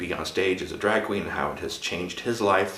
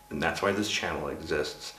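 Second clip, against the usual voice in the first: ordinary talk, nothing marked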